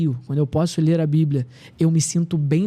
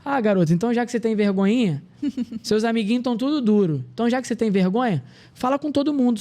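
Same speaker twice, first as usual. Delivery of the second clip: higher, teasing father's voice with a laugh; a light imitation of a fond father teasing his shy son; affectionate father; 6 semitones higher than usual. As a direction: tease him gently like a loving Bahian dad. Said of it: "de uma forma bem carinhosa".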